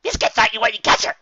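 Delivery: high, loud little dog voice; chirpy high-pitched dog character, pleased; Biscuit's voice. A narrator's reading.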